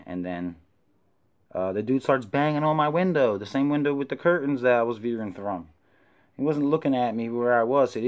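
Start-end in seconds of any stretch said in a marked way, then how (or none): none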